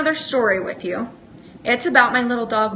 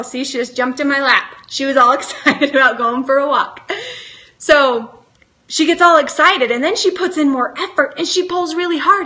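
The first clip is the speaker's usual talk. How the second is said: usual speaking voice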